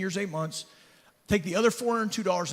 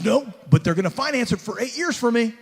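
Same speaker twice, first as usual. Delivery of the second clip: mock-boastful, raised voice; proud; 6 semitones higher, 6 dB louder than usual. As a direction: brag about the financing like a prize. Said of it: "really proud".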